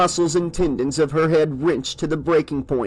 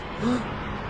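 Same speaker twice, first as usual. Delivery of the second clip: sharp gasp; 8 semitones higher, 8 dB quieter than usual. Acted. Sees eyes in the dark.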